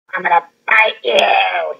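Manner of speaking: loud, slow shouted threat; retold scream. Mother retelling her son's tantrum at the doctor, loud slow yell. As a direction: yell it slowly like a child mid-tantrum. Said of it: "screams at me".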